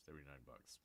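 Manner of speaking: low, very soft mutter of "Seventy-nine bucks"; muttered sticker shock. Real, not acted. Price-shocked viewer muttering to himself, low and soft.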